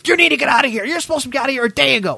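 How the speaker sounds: mimicked high-pitched telling-off; head-bobbing scolding lady. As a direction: scold in her high voice, speeding up. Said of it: "hands on her hips, her head's bobbing".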